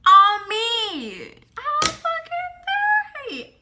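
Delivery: high-pitched outraged shriek. Real, not acted. Storyteller to viewers, shrieking in outrage. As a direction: squeal it in disbelief and fury.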